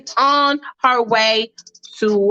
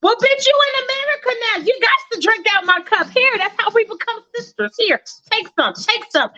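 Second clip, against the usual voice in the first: put-on much higher, fast, pushy party girl voice; pushy roommate; 10 semitones higher than usual. Roommate pushes her drink.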